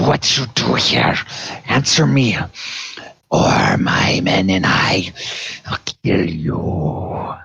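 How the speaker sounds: snarling gnoll with hissing breathy edges; snarling with hyena giggles. Acted. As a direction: growl it out like a hungry hyena-man. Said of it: "So the gnoll says".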